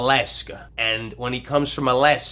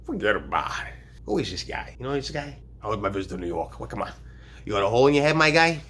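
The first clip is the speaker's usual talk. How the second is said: put-on Brooklyn accent; faster than usual.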